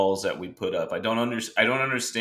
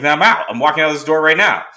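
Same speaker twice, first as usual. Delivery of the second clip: defiant walkout; 7 semitones higher, 7 dB louder, faster than usual. Retold or imitated. Walking out on producers.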